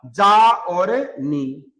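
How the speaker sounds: जा और नी, split slowly into separate syllables. Shown as a demonstration. Teacher breaking the word into syllables for students, slow and deliberate. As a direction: spell out जा और नी slowly and firmly. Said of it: "ये तो है जानी".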